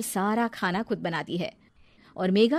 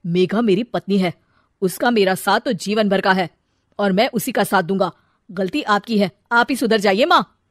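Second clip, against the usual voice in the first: firm; 7 dB louder than usual. Acted. Son sides with wife.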